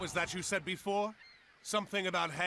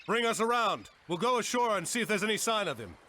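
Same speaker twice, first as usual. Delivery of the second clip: higher and louder command; ordering crew; 6 semitones higher, 4 dB louder than usual. Acted. Captain orders the crew to bring the ship ashore, calling out. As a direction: call it across the deck.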